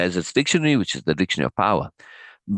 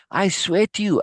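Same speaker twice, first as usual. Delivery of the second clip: higher, earnest swearing; a fervent, raised protest; genuine fervor; 10 semitones higher than usual. A narrator's reading. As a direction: plead it with real fervor. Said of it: "I said with genuine fervor".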